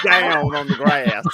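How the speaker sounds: slow, drawn-out Southern grass; Southern diphthong. Retold or imitated. Friend echoing the boy's Alabama drawl. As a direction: savor the Alabama diphthong with a laugh. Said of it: "Oh, the diphthong".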